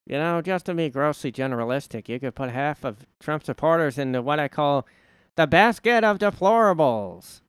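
high, loud politician impression; Hillary. Politician sorting Trump supporters, building mock speech. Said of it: "is that Hillary quote".

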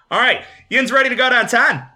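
hammed-up Pittsburgh accent pitch. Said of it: "I'm gonna do a Pittsburgh accent the whole time".

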